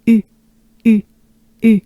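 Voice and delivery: "U" short, with nearly closed mouth; French u sound. Shown as a demonstration. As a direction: droop the jaw into French "U". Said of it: "Take a good breath".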